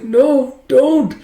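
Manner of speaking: put-on high, childlike begging; scared plea. Captive girl begging her kidnappers, scared plea. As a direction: plead in a small high voice.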